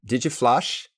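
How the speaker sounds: "Did you flush" said as "didje flush"; quick, run-together pronunciation. Shown as a demonstration. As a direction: blend "Did you" into one word, "didje". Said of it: "je le prononcerai comme ça".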